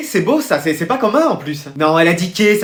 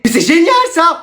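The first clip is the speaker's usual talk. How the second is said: high, delighted exclamation; thrilled praise; 6 semitones higher than usual. Acted. Parent praises child's colouring, thrilled. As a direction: burst out with proud delight.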